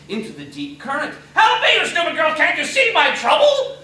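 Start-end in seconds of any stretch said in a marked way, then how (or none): 1.3-3.8 loud, higher scolding dwarf voice; angry dwarf. Acted. Dwarf scolds the staring girls, loud angry rant. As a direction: berate the gaping girls.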